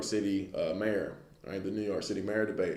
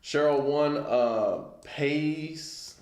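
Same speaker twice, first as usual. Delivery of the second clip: usual speaking voice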